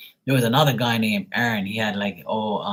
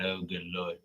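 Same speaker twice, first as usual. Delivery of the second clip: low, much softer weary groan of words; exasperated aside; 4 semitones lower, 13 dB quieter than usual. Retold or imitated. Streamer reacts to Marley mocking the scammer, low weary mutter. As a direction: sigh it out in disbelief.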